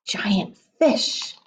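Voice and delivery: hushed whisper; a suspense whisper. Acted. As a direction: whisper the danger. Danger under the water.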